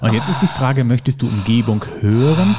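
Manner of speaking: normal talking voice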